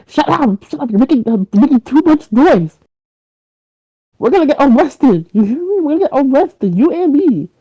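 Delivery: high, frantic hushing rant; panicked scolding. Acted. Kidnapper rushing in to silence his captive, panicked scolding.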